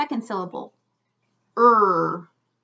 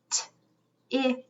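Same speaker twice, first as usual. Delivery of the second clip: ordinary delivery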